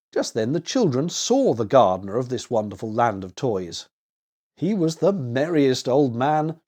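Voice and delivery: usual speaking voice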